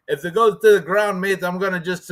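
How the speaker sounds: a weak, half-hearted try at Cockney; failed Cockney accent. Retold or imitated. Quoting Pimblett's ground threat in a lightly done Cockney accent. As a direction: mimic Paddy's Cockney and fall short of it. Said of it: "I can't do the Cockney accent".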